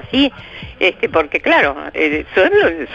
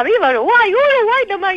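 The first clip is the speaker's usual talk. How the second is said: festive mock shout; 10 semitones higher than usual. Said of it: "Y yo gritaba".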